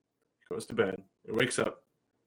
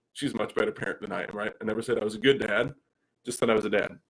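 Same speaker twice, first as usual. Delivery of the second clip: ordinary delivery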